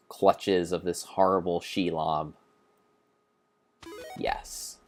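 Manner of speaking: ordinary delivery, nothing marked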